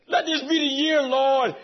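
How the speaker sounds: preaching at full force. Real, not acted. Preacher crying out to God before the congregation, fervent. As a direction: cry it out to the Lord with fervor.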